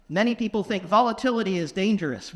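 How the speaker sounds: ordinary delivery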